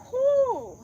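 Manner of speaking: high breathy whew of effort; strained whew. Real, not acted. Yoga teacher straining in balance, high whew. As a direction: blow out the strain of the pose.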